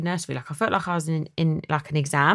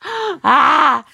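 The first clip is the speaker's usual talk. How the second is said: mock gasp; 9 semitones higher, 9 dB louder than usual. Dreading leaving son at nursery.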